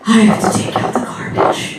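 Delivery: breathy, deflated mock-misery; hammed-up boyfriend moping over the garbage; mock depressed. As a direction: sound utterly defeated by a chore.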